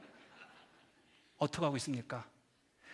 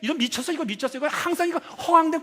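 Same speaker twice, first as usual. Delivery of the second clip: high-pitched, heated mock scolding; acted-out dismissive harangue; scornful belittling; 6 semitones higher, 4 dB louder than usual. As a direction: pour scorn on someone's foolish dream. Voices the world scorning a dreamer.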